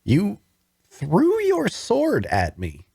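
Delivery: high, slow, disbelieving accusation; stunned that he did it; shocked accusation. Acted. Wounded man confronting the watcher who speared him, shocked and slow.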